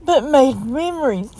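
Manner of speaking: holding back real tears while speaking; real crying, voice cracking up high. Real, not acted. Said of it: "I don't mean to cry on you".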